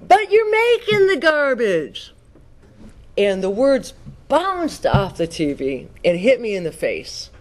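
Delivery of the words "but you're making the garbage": much higher, slow outburst at the TV; blurted outburst. Retold or imitated. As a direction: stand up and cry it out at the screen. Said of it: "I stood up and I go".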